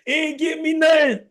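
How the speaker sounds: high-pitched mock wail; crying child. Daughter complaining to dad about her brother, crying. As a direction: whine it out like a crying little girl. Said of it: "she crying".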